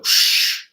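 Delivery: whispered shh of leaves; rustling bush. Retold or imitated. Streamer imitating the bushes as demons leave, whispered rustle. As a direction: breathe out a rustling hush. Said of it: "you'll hear that the bush go".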